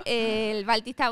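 ordinary talk

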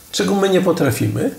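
usual speaking voice

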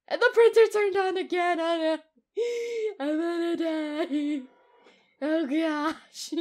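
loud, high scared voice; startled by the printer; frightened cry. Real, not acted.

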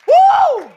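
high, loud "woo"; piercing holler of joy; praise whoop. Real, not acted. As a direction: let out a sudden church whoop.

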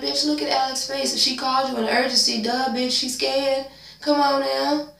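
high, incredulous telling-off; high sassy exasperation at the nurse, fast in the middle; sassy scolding. Retold or imitated.

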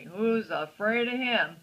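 ordinary delivery

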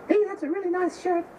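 tiny, high-pitched squeak; little voice. Acted. Bowl of nuts complimenting a drinker, tiny high voice. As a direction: pipe up like something very small. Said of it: "he hears this little voice going".

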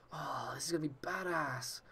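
hushed whisper. Real, not acted. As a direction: breathe it out excitedly. Whispered in excitement.

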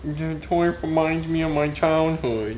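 Jeffy voice. Acted. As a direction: drag it out like a dopey kid. Repeating line as Jeffy.

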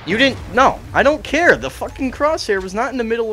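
high, loud and indignant; angry protest. Real, not acted. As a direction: cut off the objection hard. Arguing with the game's hit detection.

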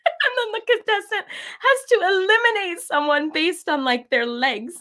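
high, squeaky voice fighting laughter; laughing. Real, not acted. Describing the naked dating show, helpless laughing. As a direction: try to get it out while cracking up.

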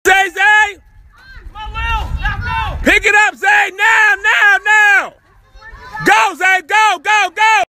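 loud, high trackside yelling; dad yelling from the stands as son races; cheering shout. Real, not acted. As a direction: scream him on to the finish. Dad yelling at son's race.

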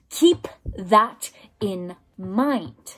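very slow, every word set apart; drawn out, each word weighted; slow emphatic repeat. Real, not acted. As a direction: drive it home word by word.

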